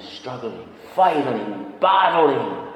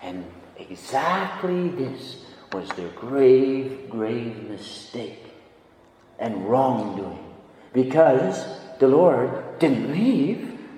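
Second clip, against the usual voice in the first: ordinary delivery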